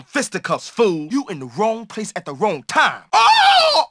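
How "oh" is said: sudden high loud yelp; shocked yelp. Acted. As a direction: yelp as if struck. Sudden hit reaction.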